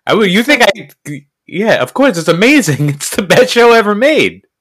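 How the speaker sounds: carried away defending her favorite show; overexcited fan. Real, not acted. Defending the show she has pushed for years, overexcited. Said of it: "Okay, relax".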